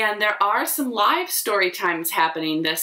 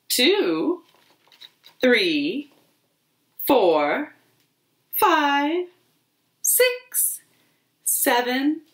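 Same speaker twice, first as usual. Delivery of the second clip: put-on gentle storybook reading for little kids; lightly sing-song picture book narration; reading to children; slower than usual. A narrator's reading. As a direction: read it slowly to a group of toddlers. Reads picture book aloud.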